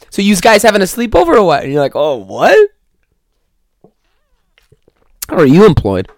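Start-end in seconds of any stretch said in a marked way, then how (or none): 0.1-1.6 high, loud dopey voice; mock yous guys speaker. Mocking a yous guys speaker, hammed-up. 2.0-6.1 loud, disbelieving retort; incredulous. Retold or imitated. Answering the yous guys guy, mock disbelief.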